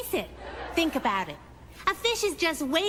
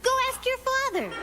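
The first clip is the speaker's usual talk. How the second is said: higher, dismissive mom voice; mom brushing off; 10 semitones higher than usual. Retold or imitated. Mom brushing off the kid, in a higher voice. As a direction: wave the kid off. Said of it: "you know what she said".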